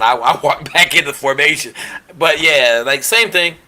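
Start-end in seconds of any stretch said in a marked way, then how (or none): none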